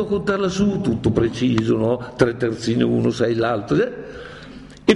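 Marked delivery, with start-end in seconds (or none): none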